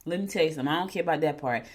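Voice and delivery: ordinary talk, nothing marked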